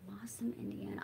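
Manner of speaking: barely audible whisper while reading; whispered to herself. Real, not acted. Streamer reads a chat comment under her breath, whispering. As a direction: murmur the name as you read it. Reading a viewer's town aloud.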